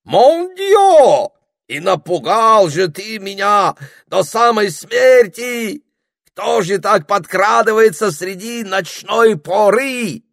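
put-on high, loud, drawn-out French-accented exclamation; хрипловатый французский акцент. A narrator's reading. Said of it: "хрипловатым голосом с сильным французским акцентом". (с сильным французским акцентом, протяжно и громко)

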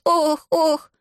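high acted «ох, ох»; jerky, high-pitched cries of distress; yelping groan. A narrator's reading. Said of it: "вскрикнул Иван и дёрнулся". (высокие охи)

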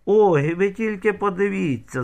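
high exclamation, loud on the first word; squealed outcry. A narrator's reading. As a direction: squeal it out in mild outrage, storyteller style. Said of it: "заверещала нечепориха".

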